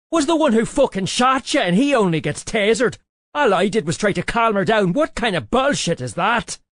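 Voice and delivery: high, loud furious rant; furious shout. Acted. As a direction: scream it in fury at your boss. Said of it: "He was pissed".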